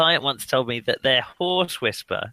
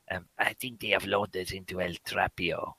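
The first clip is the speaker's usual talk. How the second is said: mock Spanish accent; 6 semitones lower, 7 dB quieter than usual. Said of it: "You just say it in a Spanish accent".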